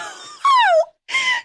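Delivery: high shocked shriek with laughter; shocked laughing scream. Real, not acted. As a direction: shriek in disbelief. Outburst of shock.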